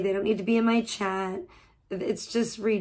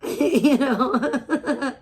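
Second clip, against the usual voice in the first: loud, higher, through laughter; laughing; 4 semitones higher, 5 dB louder than usual. Real, not acted. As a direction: crack up at your own joke while talking. Laughing at own cat joke.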